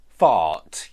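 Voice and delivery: fart with a long ah vowel; ah sound. Shown as a demonstration. Teacher modelling the ah vowel in fart. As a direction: open ah in fart, low. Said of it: "exactly the same, but with a f sound".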